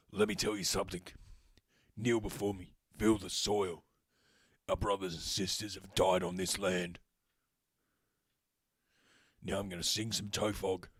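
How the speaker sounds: lightly deepened gravel-free Batman growl; a hint of a dramatic superhero hero; deep Batman voice. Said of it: "deep, dramatic Batman voice".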